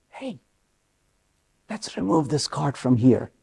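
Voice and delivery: very soft, low near-whisper; hushed whispering. Retold or imitated. Buddy at a wobbly house of cards, whispering. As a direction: barely voice it, as if not to disturb anything. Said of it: "everybody in the room is whispering now".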